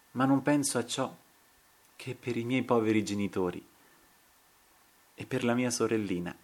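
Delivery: suddenly softened, tender voice; changed voice. A narrator's reading. Dying man thinking of his poor parents and little sister, softened. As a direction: drop the fury and speak softly. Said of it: "con voce intiramente mutata da un istante all'altro".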